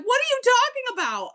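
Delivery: very high-pitched mock disbelief; retold dismayed protest. Mom to her hurt little son, high dismayed protest. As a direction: protest in a squeaky, imitated panic. Mom's heartbroken reply retold.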